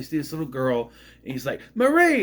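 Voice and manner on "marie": high, loud cry of a name; excited call. Retold or imitated. Parrot calling out to the little girl, excited call. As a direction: call out as if spotting a loved one.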